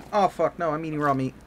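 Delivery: dismayed outburst. Real, not acted. Dismay at eating raw meat.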